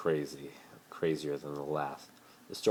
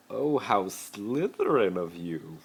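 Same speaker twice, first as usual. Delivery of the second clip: smirking; 5 semitones higher, 6 dB louder, slower than usual. Acted. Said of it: "Malfoy smirked".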